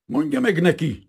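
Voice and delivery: very high, loud sharp outburst; snapped out. A narrator's reading. Captain snaps at the prince, very high and loud. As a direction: snap the words out in rage. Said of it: "csattant föl a hangja".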